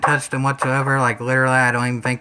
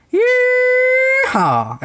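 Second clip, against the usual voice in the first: big high country whoop, much louder; megaphone yeehaw; 5 dB louder than usual. Acted. Signing off to viewers, testing the megaphone with a loud high whoop. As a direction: holler it through a megaphone like a cowboy. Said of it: "The megaphone actually did pretty cotton picking good".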